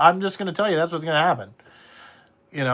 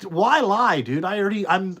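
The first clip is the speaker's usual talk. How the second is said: ordinary talk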